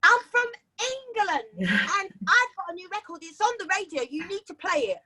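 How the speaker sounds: put-on high, chirpy girl-next-door voice; prim English girl. Asking Texan DJs to play her record, high chirpy polite voice. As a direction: sell it sweetly with manners. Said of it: "and I was like".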